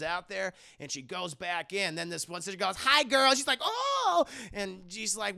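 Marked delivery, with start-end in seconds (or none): 2.8-3.3 high, loud sorority squeal; perky greeting. Retold or imitated. Sister surprising her friend, perky. Imitating sister popping up suddenly. 3.6-4.3 very high, loud mock scream; startled shriek. Woman startled by her sister, shrieking.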